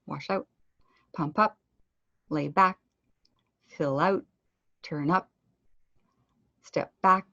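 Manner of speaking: crisp final T, P and K in "out", "up", "back"; sharp final consonants. Shown as a demonstration. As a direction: carefully finish each "out", "up", "back" on a sharp consonant. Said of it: "emphasize where in my mouth that sharpness is happening".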